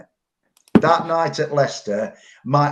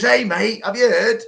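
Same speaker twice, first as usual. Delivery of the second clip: called out higher and louder; retold shout; 10 semitones higher, 5 dB louder than usual. Greengrocer calling to a friend passing the shop, loud and eager. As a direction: hail a mate across the road with news. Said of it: "he shouts at me".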